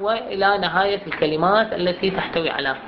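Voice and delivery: usual speaking voice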